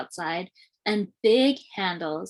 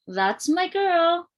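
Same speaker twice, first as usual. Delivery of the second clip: proud; 9 semitones higher, slower than usual. A narrator's reading. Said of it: "said Daddy".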